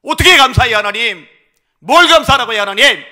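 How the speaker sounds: loud, high cry to God; a father crying out his grievance in prayer; anguished protest. Acted. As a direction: shout the complaint up at heaven.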